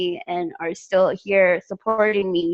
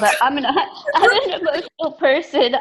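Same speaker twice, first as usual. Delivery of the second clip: laughing through tears; 7 semitones higher than usual. Real, not acted. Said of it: "I don't wanna cry".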